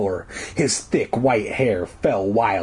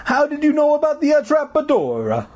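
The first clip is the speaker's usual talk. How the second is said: high, hammed-up Italian demand; stupid Italian voice. A narrator's reading. Janitor demands answers from kids, stupid Italian accent. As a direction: demand it in silly Italian, stretching the end. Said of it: "demanded in his stupid Italian voice".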